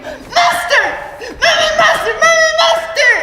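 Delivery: high, loud terrified shrieking; shrill loud frightened scream; child screaming. Acted. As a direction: scream it as a panicked little girl. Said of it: "I'll scream".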